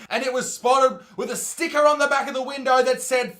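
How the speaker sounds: ordinary delivery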